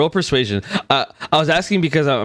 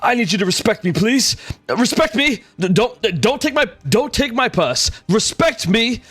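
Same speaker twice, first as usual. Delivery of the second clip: put-on high-pitched drunken bluster, slurring a little; drunk prince; 10 semitones higher than usual. Drunk prince yelling outside the tent, high drunken bluster. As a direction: ham up a tipsy prince demanding respect. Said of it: "a gruff voice yell out".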